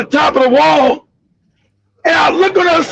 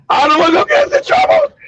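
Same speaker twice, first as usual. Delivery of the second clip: high-pitched mock outrage; indignant retort; 12 semitones higher than usual. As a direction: repeat the accusation in acted disbelief. Acted indignant comeback, repeated.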